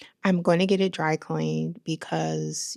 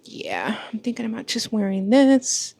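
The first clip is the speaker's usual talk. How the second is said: normal talking voice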